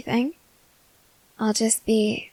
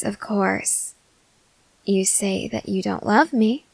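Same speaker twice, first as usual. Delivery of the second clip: usual speaking voice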